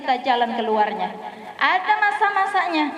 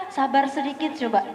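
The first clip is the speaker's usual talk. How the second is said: ordinary talk, nothing marked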